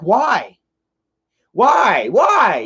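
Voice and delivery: high, swooping repeated question; retold protesting why. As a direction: replay an incredulous protest, pitch soaring.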